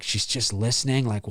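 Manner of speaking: ordinary talk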